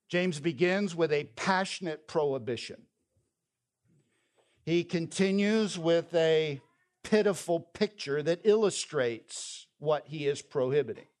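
ordinary talk

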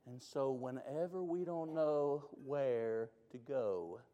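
usual speaking voice

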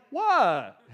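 put-on a loud, blank "What?" like a kid caught out; clueless twelve-year-old. Preacher mimics a twelve-year-old brushing off his parents, loud and blank. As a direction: play the oblivious preteen, punchy and loud. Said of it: "that sounds like a typical twelve-year-old".

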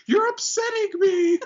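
upset wife. Retold or imitated. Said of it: "the wife is like".

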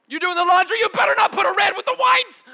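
high, loud bossy yell; barking orders. Retold or imitated. Family member yelling laundry orders at everyone. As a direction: shout the laundry rules.

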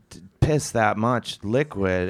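normal talking voice

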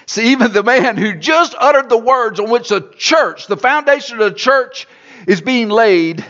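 rising pulpit emphasis; preaching build. Real, not acted. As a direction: lift it up and drive it home. Preacher rises to full force.

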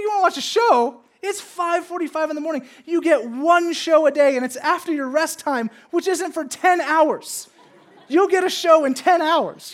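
loud, high incredulous scolding; hammed-up outraged parent at dawn; exasperated dad. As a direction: scold a toddler in comic disbelief.

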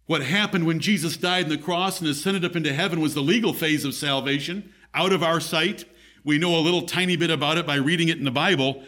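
normal talking voice

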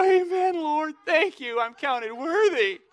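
high, drawn-out, hammed-up praise through pain; mock pious zealot. Scalded street preacher thanking the Lord, hammed-up pious cry.

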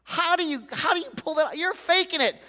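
high, incredulous challenge; exasperated rant. Retold or imitated. Preacher imagining telling off a falsely cheerful believer, high and incredulous. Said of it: "sometimes I wanna say".

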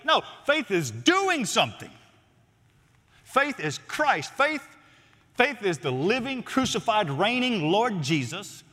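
high, insistent preacher's declaration; emphatic preaching. Real, not acted. Preacher corrects the idea that faith is just virtue, emphatic. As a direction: drive each phrase home, slowing on the key words.

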